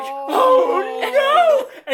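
long high wail of horror, hammed up; drawn-out theatrical scream; mock panicked scream. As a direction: imitate a terrified yell, very loud. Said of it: "like, screaming".

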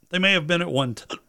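holding back a hiccup mid-word; suppressing hiccups. Real, not acted. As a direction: cut off by a hiccup. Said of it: "I'm trying to suppress a hiccup here".

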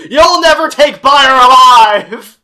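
loud, high, slow, hammed-up defiance; defiant yell. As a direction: shout it like a cornered outlaw.

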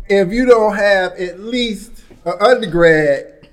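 slow, deliberate note to self; slow mock advice. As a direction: spell it out like a rule. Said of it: "mock life lesson".

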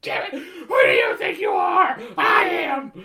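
high, loud, crying victory scream; over the top shout. Retold or imitated. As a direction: bellow it like the bowler's triumph. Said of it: "it's so over the top".

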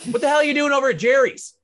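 high, loud pretend scolding; mock scolding mom. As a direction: play an outraged mom calling out. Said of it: "your mom's yelling".

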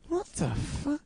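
high, quiet disbelief; shocked. Retold or imitated. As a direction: gasp it out in shock. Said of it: "I'm like".

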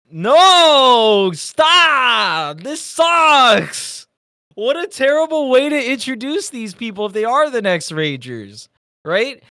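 loud, high, ranting protest; angry outburst. Retold or imitated. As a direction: yell in outraged frustration at the screen. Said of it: "And I'm like".

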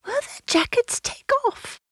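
put-on very high little girl; child's voice. Lucy asking about the lambs' coats, high child's voice. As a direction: ask in a high piping voice.

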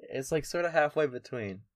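ordinary delivery

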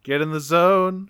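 ordinary talk, nothing marked

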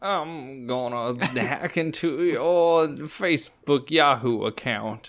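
slow Southern hacker drawl; Southern computer gentleman. Acted. Cohost joins in as Southern hacker, slow drawl. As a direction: draw the words out like a Southern gentleman. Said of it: "decided to make him a Southern gentleman".

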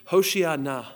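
Hoshiana said carefully as hoh-shee-AH-nah; Hebrew pronunciation. Shown as a demonstration. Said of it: "I'm gonna do my best to say".

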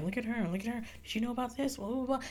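hushed, sneaky gossip whisper; mock the whispering crowd behind your back; gossipy murmur. Gossiping classmates voiced in story.

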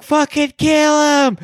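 put-on drunken crowd yell. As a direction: bellow it from the stands at the joust. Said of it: "We're just screaming".

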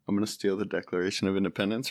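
hushed, quick, barely there Cage line; quiet Nick Cage impression. First try at Nick Cage for the hosts, quiet and rushed. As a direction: mumble it fast and soft like a timid Nicolas Cage. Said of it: "let's see, Nick Cage".

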